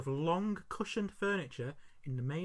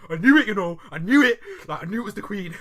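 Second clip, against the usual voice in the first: much louder, higher retold outburst; mock triumphant shout; 7 semitones higher, 15 dB louder than usual. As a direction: crow it loudly as an excited little boy. Retelling child's excited outburst.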